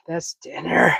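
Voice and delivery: unvoiced whisper of "That's dinner."; whispered repeat. Real, not acted. Cook repeating his answer to a viewer, whispering. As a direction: echo "That's dinner." under the breath.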